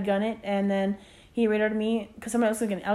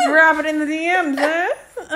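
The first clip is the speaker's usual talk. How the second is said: laughing; 6 semitones higher, 6 dB louder than usual. Real, not acted. Joking about sliding into DMs.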